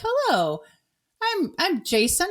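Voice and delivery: high, slow mock-cheerful waiter greeting; chirpy server. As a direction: sing it out like a peppy server. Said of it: "they'd be like".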